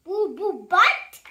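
loud incredulous echo. Acted. Reader shocked at the hippo's name, incredulous. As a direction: repeat the name in disbelief.

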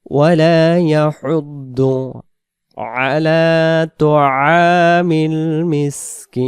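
slow, drawn-out melodic chant; Quran recitation. Shown as a demonstration. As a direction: recite it slowly with long held vowels.

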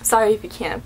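ordinary talk

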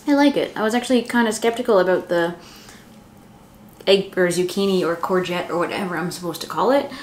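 talking with food in mouth; speaking between bites; chewing pizza. Real, not acted. Seen 1: chews before, holds slice at mouth while talking, bites again.

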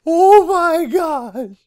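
slow, loud and high, still in shock; shaken. Real, not acted. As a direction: gasp it out after a scare. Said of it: "That scared the crap out of me".

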